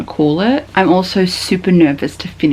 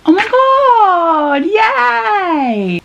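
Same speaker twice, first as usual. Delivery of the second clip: high, long-held delighted squeal; overjoyed squeal; 12 semitones higher, much slower than usual. Real, not acted. Reader thrilled love wins, drawn-out overjoyed squeal. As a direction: squeal it out, stretching every word.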